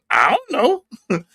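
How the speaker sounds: slow, high crying whine; drawn-out fake sob; mock whine. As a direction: whimper it like a helpless kid.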